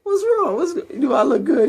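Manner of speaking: a mom preening in front of her son, hammed-up high; high mock mother. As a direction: squeak it out like a vain mom. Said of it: "She's like".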